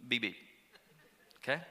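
ordinary talk

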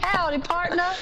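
high-pitched mock-Texan "Howdy, partner"; Southern American accent. Shown as a demonstration. Contestant egged on by host, high Southern American accent. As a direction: put on a high cowboy-style American accent. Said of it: "can you say howdy".

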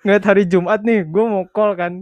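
animated. Real, not acted. Excited story about calling.

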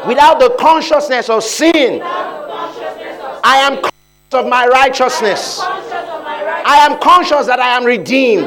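high, loud shouted declarations; preaching at full force. Acted. Preacher leading the church in shouted confessions. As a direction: proclaim each line like a battle cry.

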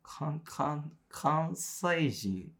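かん-、かん-、関西人 dragged out very slowly; halting slow drawl. Acted. Teasing a friend, stretching out 関西人 slowly. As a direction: stumble slowly into the word 関西人. Stammering over the word.

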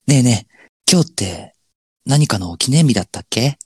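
put-on girlfriend role. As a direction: play the dreamy girlfriend. Acted skit, girlfriend part.

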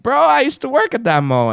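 high, loud retold amazement; acted excitement, pitched well up; excited disbelief. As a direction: blurt out an amazing coincidence. Retells amazement at the coincidence.

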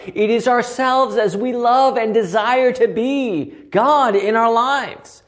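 normal talking voice

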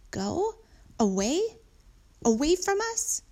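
high, rising questions; shocked questions climbing in pitch; stunned disbelief. A narrator's reading. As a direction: ask it in dismay, piece by piece. Child shocked that donkey must go.

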